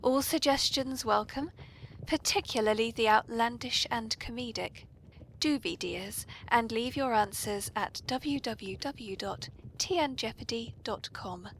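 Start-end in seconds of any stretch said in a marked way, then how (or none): none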